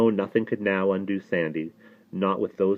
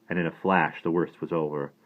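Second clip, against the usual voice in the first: plain reading voice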